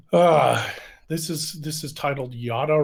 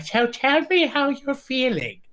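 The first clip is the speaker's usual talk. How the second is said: counselor voice; 10 semitones higher than usual. Pretend. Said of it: "And you could sit there and be like".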